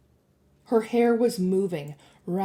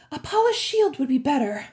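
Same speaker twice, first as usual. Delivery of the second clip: put-on higher girl's voice, appraising; lifted, matter-of-fact critique; critical young girl; 7 semitones higher than usual. A narrator's reading. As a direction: assess it like a know-it-all. Annabeth voiced assessing the sphere.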